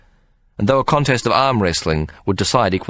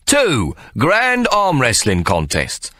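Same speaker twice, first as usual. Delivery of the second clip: slow, raised emphatic reply; Ikey, showman; 7 semitones higher, much slower than usual. Acted. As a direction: drag each word out. Ikey replies.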